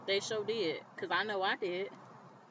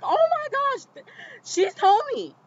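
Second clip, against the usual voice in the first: much higher and louder, a shrieked "oh my gosh"; shocked scream; 10 dB louder than usual. Retold or imitated. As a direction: scream it at the TV in disbelief. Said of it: "screaming at the T V".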